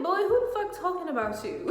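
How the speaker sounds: high-pitched retold clapback; indignant comeback. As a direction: fire it back, acting out the outrage. Acting out her angry comeback to him.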